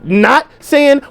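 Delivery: usual speaking voice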